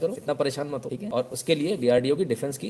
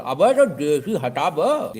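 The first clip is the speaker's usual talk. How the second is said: slow, stretched-out bossy demand; lazy Kanpur-style mock command; drawn-out mock order; 3 semitones higher, 6 dB louder, much slower than usual. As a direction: drag it out like a pushy customer. Know-it-all orders word removed.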